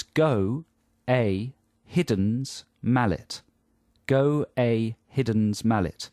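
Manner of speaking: "Go a Hidden's mallet" as slow, separate words. Shown as a demonstration. Host dictates to listeners, "Go a Hidden's mallet" word by word. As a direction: pronounce "Go a Hidden's mallet" deliberately as written. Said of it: "if you write down the words".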